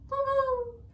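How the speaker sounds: very high, loud little chirp; baby dragon squeak. Acted. Baby dragon answering her mother, squeaky. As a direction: squeak like a tiny pink dragon. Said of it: "said Fodla, the little pink dragon".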